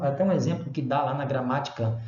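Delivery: ordinary talk, nothing marked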